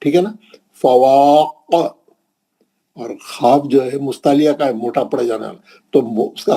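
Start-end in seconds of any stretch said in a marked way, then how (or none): 0.7-2.0 slow, drawn-out recitation with a bouncing qalqala at the end; tajweed recitation, strong qalqala on the final letter; Quran recitation. Shown as a demonstration. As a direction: recite it slowly and let the last letter echo. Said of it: "खलखले कुबरा करना है".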